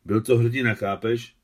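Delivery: loud, heated defence; offended insistence. A narrator's reading. Adam defends the dead colonel as a hero, offended insistence. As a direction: insist on it, stung. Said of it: "řekl uraženě Adam".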